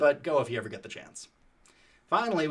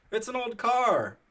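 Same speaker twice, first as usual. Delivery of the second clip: higher, slow, dopey mock voice; dumb excuse; 10 semitones higher, 4 dB louder, slower than usual. Tyler shrugging off the rat's nest, dopey slow voice. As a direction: play the friend as clueless. Friend's lame excuse quoted.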